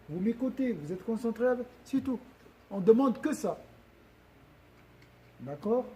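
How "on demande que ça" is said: ordinary talk, nothing marked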